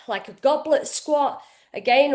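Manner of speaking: normal talking voice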